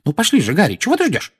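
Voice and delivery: put-on high, insistent old woman; high-pitched impatient call; impatient urging. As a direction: hurry a dawdler along. Woman hurries the boy along.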